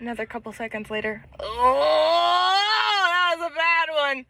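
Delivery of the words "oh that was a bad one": mock pained groan, a bit higher; lightly voiced pained groan; drunk dad groaning. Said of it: "You sound like the professor from The Simpsons".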